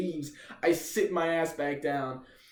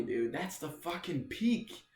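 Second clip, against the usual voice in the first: ordinary delivery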